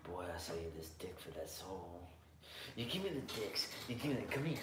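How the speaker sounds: lightly voiced creepy southern stranger, soft; creepy Louisiana guy. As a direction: hush it like a sleazy swamp dealer. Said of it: "That's creepy Louisiana guy for you guys".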